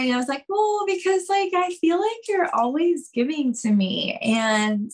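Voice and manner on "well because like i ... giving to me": higher, sheepish confession; bashful. Retold or imitated. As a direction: sheepishly admit you feel bad. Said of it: "I probably said it just like this too".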